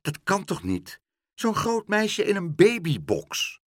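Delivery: high-pitched, incredulous protest; indignant disbelief. A narrator's reading. Pluk protests about the playpen to meneer Pen, indignant disbelief. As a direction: protest as if it can't be true.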